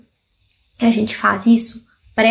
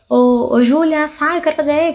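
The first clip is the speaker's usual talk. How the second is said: impatient yell at the door; 5 semitones higher, 3 dB louder than usual. Retold or imitated. People banging on bathroom door.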